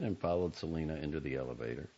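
plain reading voice

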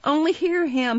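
high, mildly loud outcry; indignant cry. A narrator's reading. Young woman to bystanders, high mock-indignant cry. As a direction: cry it out to the crowd. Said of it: "cried the young woman".

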